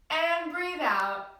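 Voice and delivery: drawn-out calm cue to exhale; slow breathing cue. Shown as a demonstration. Teacher guiding children to breathe out slowly. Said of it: "out through our mouth".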